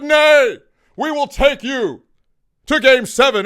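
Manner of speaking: loud, high, slowed vow; defiant proclamation. Acted. A rival player vowing to force game seven, defiantly. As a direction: declare it like a challenge.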